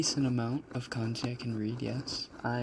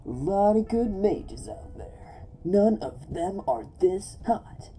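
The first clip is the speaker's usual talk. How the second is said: high, loud game-hero boast; Ezreal; 8 semitones higher, 7 dB louder than usual. Acted. Streamer doing a requested game voice, loud and high. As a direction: throw it out big and high, showing off. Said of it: "Can you do an Ezreal voice?".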